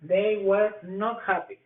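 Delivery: They were not happy. slow, falling; slow model answer. Shown as a demonstration. Teacher models the negative answer slowly. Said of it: "O pudiera ser".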